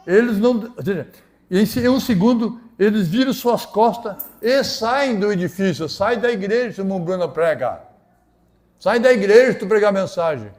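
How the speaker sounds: loud, high pulpit cry; impassioned preaching. Real, not acted. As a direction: drive each phrase home like a fired-up preacher. Preaches at full force.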